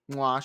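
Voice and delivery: smacked kiss sound of approval; quick verbal kiss of praise; chef's kiss mwah. Real, not acted. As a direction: blow an appreciative kiss, mwah. Chef's kiss praise of her gameplay.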